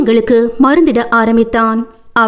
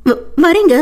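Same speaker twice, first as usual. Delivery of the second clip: high, hesitant answer; a timid young wife calling back; heroine's voice; 7 semitones higher than usual. Acted. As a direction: stammer it out, flustered and high. Heroine answers, raised pitch.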